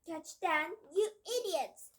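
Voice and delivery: high, loud furious scream; witch's scream. Acted. Grand High Witch screaming at the witches, high and loud. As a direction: scream at your useless helpers. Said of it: "screamed the Grand-Eyed Witch".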